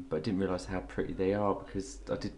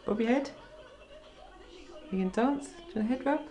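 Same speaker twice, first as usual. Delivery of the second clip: pet talk; 13 semitones higher, faster than usual. Real, not acted. Owner coaxing a parrot to dance, high pet talk. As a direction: tempt a pet in a high sing-song.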